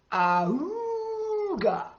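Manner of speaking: high, long swooping bagpipe hoot; bagpipe "auga" honk. Acted. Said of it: "who said only one thing".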